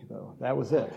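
usual speaking voice

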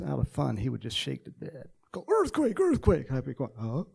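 normal talking voice

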